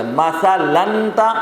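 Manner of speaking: مثلاً said slowly with a held final n; slow demonstration of the n ending in مثلاً; recited example word. Shown as a demonstration. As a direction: stretch مثلاً out as masa-lann before تھا. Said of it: "ایک مثال ہے".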